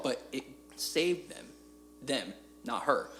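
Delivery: normal talking voice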